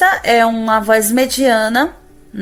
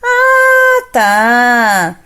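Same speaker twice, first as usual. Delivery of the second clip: surprised ah; 2 semitones higher, 6 dB louder than usual. Shown as a demonstration. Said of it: "aí você faz assim".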